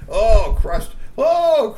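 crushed cried out high and loud; gleeful cry. A narrator's reading. Storyteller savoring the troll's fall, gleeful high cry.

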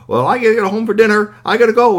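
high, loud, rushed excuse; embarrassed escape. A narrator's reading. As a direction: blurt it fast and flee. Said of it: "He was so embarrassed".

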